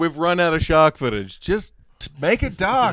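mock executive order. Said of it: "So, like".

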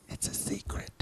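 hushed whispered secret. Retold or imitated. Daughter whispering in story.

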